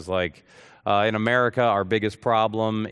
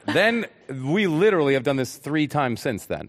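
usual speaking voice

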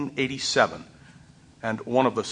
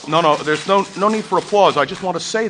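firm, louder, pitched-up plea to stop clapping; calling over applause; 5 semitones higher, 7 dB louder than usual. Real, not acted. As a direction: lift your voice over the clapping and wave it down. Speaker calls over audience applause.

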